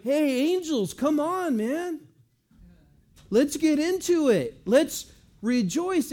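loud, high-pitched rallying cry; rally the crowd at full voice; excited shout to get everyone going; fired up. Acted. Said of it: "now he's so fired up".

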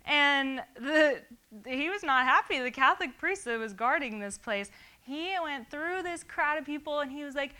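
high, loud, bubbly storytelling; excited retelling. Retold or imitated. Telling the church about the Israel trip, excited. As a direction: relive the giddy fun at full energy. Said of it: "I'm all excited".

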